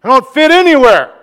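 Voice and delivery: loud, high anguished lament to God; lamenting cry. Retold or imitated. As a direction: cry out to the Lord in frustration. Lament prayer quoted in sermon.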